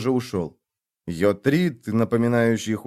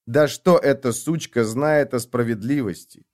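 ordinary delivery, nothing marked

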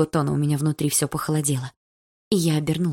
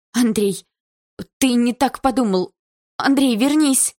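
higher, louder, rising panic; desperate shout; 8 semitones higher, 5 dB louder, slower than usual. A narrator's reading. Wife shouts after her husband to come back. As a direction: call after him before he's gone.

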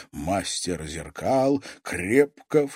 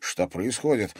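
ordinary delivery

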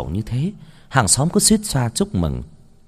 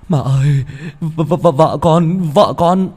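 high, louder, stuttering in tearful panic; panicked stammer; 6 semitones higher, 5 dB louder, slower than usual. Acted. Son stammering to his mother about his wife, panicked and tearful. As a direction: choke on the words, near tears. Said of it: "Anh ta hốt hoảng, đôi mắt rưng rưng".

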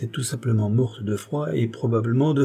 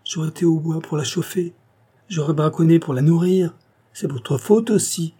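high, rising lament; loud, pitched-up distress; anguished reproach; 7 semitones higher, 4 dB louder than usual. A narrator's reading. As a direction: build from regret into accusation. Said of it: "accusant ceux qui se trouvaient là".

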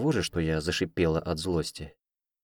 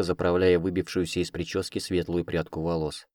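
ordinary delivery

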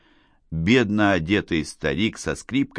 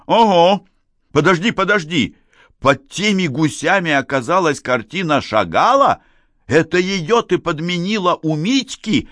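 high, loud, frantic questioning; yelling like mad; 10 semitones higher, 5 dB louder than usual. A narrator's reading. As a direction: shout as the shock sinks in. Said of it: "Теперь уже Гарик орал, как сумасшедший". (ошеломлённый крик)

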